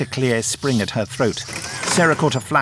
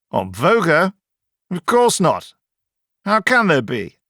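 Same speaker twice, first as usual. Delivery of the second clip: higher, louder, scoffing professor; dismissive professor; 7 semitones higher, 4 dB louder than usual. A narrator's reading. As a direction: brush the idea off. Kelman dismissive.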